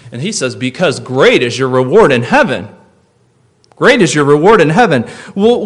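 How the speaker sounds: loud, high, impassioned sermon climax; full-voiced revival preacher calling heaven; preaching at full force. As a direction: preach it out with rising fervour.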